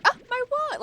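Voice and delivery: high, loud dismayed cry; Alex Russo wail. Retold or imitated. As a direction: wail like a kid who lost her wand. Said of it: "where Alex Russo goes".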